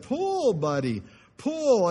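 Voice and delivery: very high loud pull, then a slow coaxing buddy pull; a farmer calling his blind horse Buddy, high then slow. Acted. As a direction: holler it out, then ease into the name. Farmer calls horse a fourth time.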